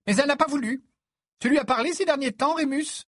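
high-pitched, loud, fussing mother; insistent mother figure. Acted. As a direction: press the questions in a high voice. Narrator voices Mrs Weasley. (voix de mère aiguë et insistante)

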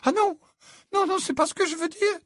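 very high, hurried-sounding denial; flustered protest. A narrator's reading. Mother denying what the fiancée accused her of, flustered.

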